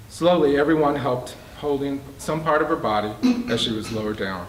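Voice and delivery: usual speaking voice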